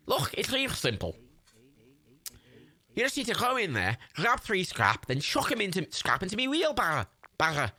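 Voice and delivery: high put-on Scouse instructions; Scouse Jimmy. Scrapper telling helper to fill the wheelbarrow, high Scouse accent. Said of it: "I said I'd do the Scouse accent".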